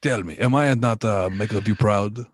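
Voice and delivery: mock-dramatic Spanish accent. Son asking father if he is proud, Spanish accent. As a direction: plead in a put-on Spanish accent. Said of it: "this is the accent that I should have".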